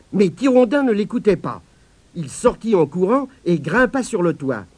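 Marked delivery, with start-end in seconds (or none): none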